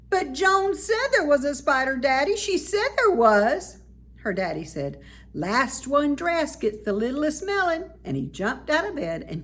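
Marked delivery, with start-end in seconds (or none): 0.1-3.8 put-on high, pleading little girl; whiny child. A narrator's reading. Child protesting to father. 5.3-7.9 playful dad. A narrator's reading. Father playfully challenging daughter.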